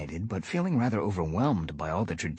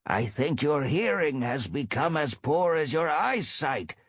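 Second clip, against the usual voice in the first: impatient snap; 8 semitones higher than usual. Acted. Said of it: "snapped Small Ear impatiently".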